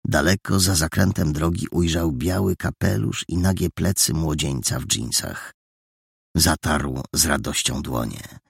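ordinary delivery

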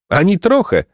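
high, lightly amused denial; laughing reply. A narrator's reading. Placek has no pity for the thieves, amused reply. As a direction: toss it off merrily, pitched well up. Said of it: "zaśmiał się Placek".

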